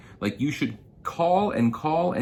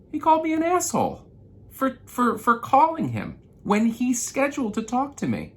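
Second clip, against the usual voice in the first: indignant; 4 dB louder than usual. Real, not acted. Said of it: "this just really pissed me off".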